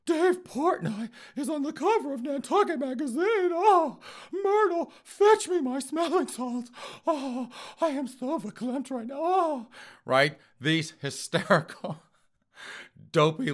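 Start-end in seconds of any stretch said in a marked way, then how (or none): 0.0-9.7 much higher, louder, prissy society-lady falsetto; snooty rich lady. Retold or imitated. Host imitating snooty Nantucket liberals, high, fainting society-lady voice. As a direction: clutch the pearls and swoon in falsetto. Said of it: "liberals out on Nantucket Island going".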